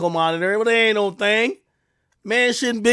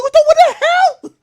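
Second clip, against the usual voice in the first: high, loud cry of disbelief; high shocked cry at donuts; 9 dB louder than usual. Retold or imitated. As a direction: squeal it in shock. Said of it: "I'm like".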